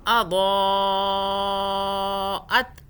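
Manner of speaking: اضاءت chanted with the vowel held six counts; long madd recitation. Shown as a demonstration. Teacher recites to show six-count stretch, chanted. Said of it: "دو کو ہم نے کتنا کھینچا".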